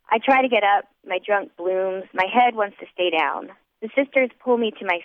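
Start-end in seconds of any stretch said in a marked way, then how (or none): none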